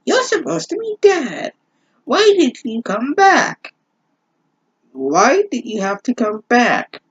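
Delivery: very high, anguished pleading; hammed-up high despair; distraught daughter. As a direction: beg him to say why. Rachel confronts her returned father.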